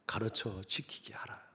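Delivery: Jesus charging disciples, whispered; whispered command. Acted. Scripture quote ending.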